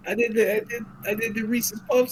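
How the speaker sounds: high, loud, frantic put-on confession; confessing Grisha voice. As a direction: blurt it out like a guilty madman, fast.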